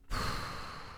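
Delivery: long, soft breath out; calming breath. Acted. Parent pausing before answering an upset child, calming breath. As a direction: let out a slow, steadying sigh. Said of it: "You take a breath".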